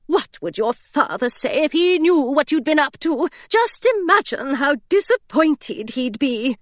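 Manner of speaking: a governess lecturing her foolish charge; prim scolding governess. Acted.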